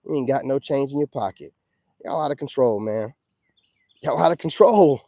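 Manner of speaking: usual speaking voice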